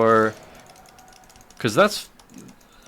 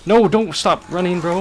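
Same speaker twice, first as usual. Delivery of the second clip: higher, louder urgent call; urgent shout; 7 semitones higher, 4 dB louder, slower than usual. Real, not acted. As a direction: yell at a teammate to keep going. Teammate fleeing in fight.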